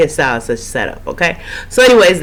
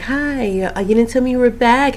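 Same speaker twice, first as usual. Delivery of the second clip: mock girlish pleading voice; Andyisms; 8 semitones higher than usual. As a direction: imitate her sweet, needy greeting, pitched up. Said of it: "the Andyisms".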